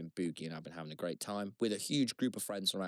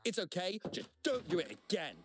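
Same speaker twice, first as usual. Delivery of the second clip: retold telling-off; 8 semitones higher than usual. Strangers answer his apology, retold.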